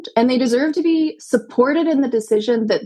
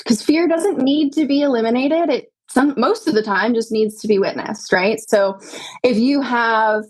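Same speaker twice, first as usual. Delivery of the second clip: normal talking voice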